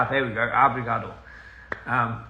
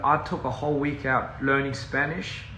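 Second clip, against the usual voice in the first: ordinary delivery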